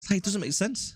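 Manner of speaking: normal talking voice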